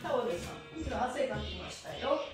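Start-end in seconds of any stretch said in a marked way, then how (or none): none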